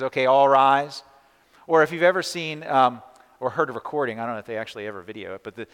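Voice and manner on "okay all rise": loud, slow bailiff call; court bailiff. Retold or imitated. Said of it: "The guy that comes into the court and says".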